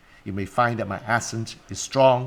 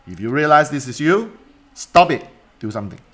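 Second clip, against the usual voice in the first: louder, sharp stop order; firm command; 3 semitones higher, 5 dB louder than usual. Real, not acted. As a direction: snap them out of it. Coach scolding procrastinators.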